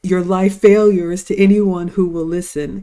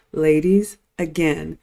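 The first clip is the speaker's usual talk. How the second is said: usual speaking voice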